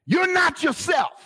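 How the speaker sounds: high, punchy exclamation; retold exclamation. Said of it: "You know how somebody said".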